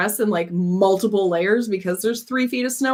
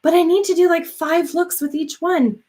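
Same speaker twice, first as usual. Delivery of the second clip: anxious self-pressure; 9 semitones higher than usual. Retold or imitated. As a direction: quote your own panicky overthinking. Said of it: "I'm like".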